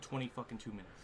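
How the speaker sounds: ordinary delivery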